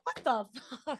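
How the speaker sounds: 2 high, laughing mock outrage; 4 laughing, disbelieving celebrity; 1 baffled celebrity. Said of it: "they'd be like".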